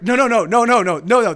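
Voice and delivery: loud, insistent string of refusals; urgent objection. Real, not acted. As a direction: cut them off loudly. Storyteller urgently objects to interruption.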